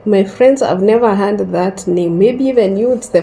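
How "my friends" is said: ordinary delivery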